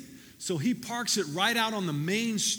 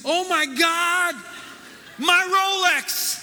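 shocked cry; 11 semitones higher, 8 dB louder, much slower than usual. Acted. Punchline, man sees missing arm.

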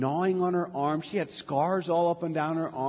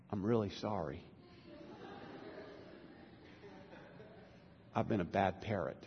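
low, soft, humbled apology; hushed and sheepish, with pauses between pleas; contrite parrot; 8 semitones lower, 17 dB quieter than usual. Acted. Parrot begs the woman's forgiveness after the freezer, subdued and contrite.

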